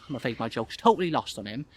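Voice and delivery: ordinary delivery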